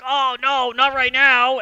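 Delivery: high, very loud melodramatic cry; hammed-up wail of resistance; acted anguished shout. Ocelot fights being taken over before he can leave, shouted protest.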